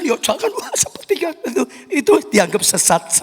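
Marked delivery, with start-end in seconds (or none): 0.0-1.6 squeaky high mocking wah; mock exclamation. Preacher mocks a pastor who forbids lively praise, high scoffing cry.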